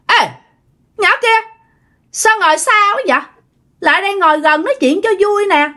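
high, piercing creepy summons; shrill possessed sister-in-law. Retold or imitated. Possessed sister-in-law beckoning the frightened girl to come sit closer, shrill. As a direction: call her over in a high, eerie screech. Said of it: "cái giọng của chị á là the thé".

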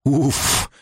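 high, appalled, shuddering; shocked disgust. Acted. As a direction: shudder at the thought. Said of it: "Симона покачала головой".

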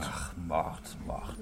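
soft whisper of Mord. Acted. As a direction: breathe the word away, barely voiced. Drunk man dismisses murder.

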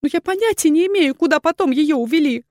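high, anxious woman's voice; frightened confession; fearful explaining. A narrator's reading. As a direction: justify yourself in a high, pleading voice. Terrified woman explains herself to duke.

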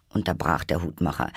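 usual speaking voice

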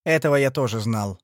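plain reading voice

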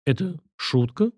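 slow, faltering question; bewildered babble. A narrator's reading. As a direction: ask haltingly, thrown off balance. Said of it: "пролепетала женщина". (растерянный лепет)

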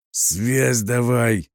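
bellowing roar. A narrator's reading. Батон demands communications from the officer, roaring. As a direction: roar like a wounded rhino. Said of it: "раненым носорогом заревел Батон".

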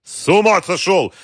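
loud, high cry of indignation; outraged shout. A narrator's reading. General shouting down the phone at Wolf, outraged. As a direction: shout it, appalled and angry. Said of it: "крикнул генерал".

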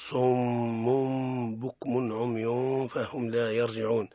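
"صم" with its tanween turned into a long hummed m before "بكم"; iqlab demonstration. Shown as a demonstration. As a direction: draw out a nasal meem at the end of "صم", slowly. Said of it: "بمعنى ذلك في بيانها تقرأ هكذا". (إقلاب التنوين ميمًا مع غنة ممدودة في "صم")

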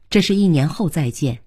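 plain reading voice

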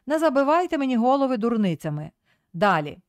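usual speaking voice

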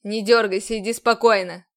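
loud commanding order; booming voice. A narrator's reading. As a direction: boom it out like an officer. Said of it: "Громыхнул голос командора".

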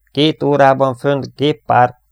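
loud, slow, high call of the bearing; loud report. Acted. Verge reports fighters to the crew, loud and slow. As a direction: bark out the sighting. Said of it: "jelentette Verge".

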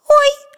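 very high, loud frightened yelp; a sharp little yelp of fear. A narrator's reading. The girl yelps in fright at Petya's warning. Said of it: "ойкнула от страха".

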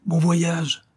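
high-pitched farewell call. A narrator's reading. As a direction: call it out to departing comrades. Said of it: "crièrent les camarades". (lancer un adieu)

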